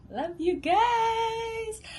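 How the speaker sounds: very high, drawn-out joyful call; elated sing-song farewell. Real, not acted. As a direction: stretch it out, beaming. Happy sign-off.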